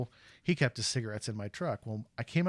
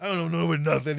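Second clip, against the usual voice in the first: louder, higher, mock grumpy father; mad dad; 6 semitones higher, 6 dB louder than usual. Teller voicing his annoyed father refusing to pay, mock grumpy. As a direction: grumble it back like a sulky dad. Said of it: "He got all mad".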